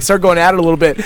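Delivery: ordinary talk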